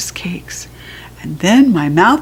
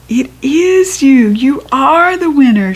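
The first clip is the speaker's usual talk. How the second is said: happy yell; 5 semitones higher, 4 dB louder than usual. A narrator's reading. Mother yelling happily to her daughter, louder and higher. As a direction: celebrate her win. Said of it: "she yelled".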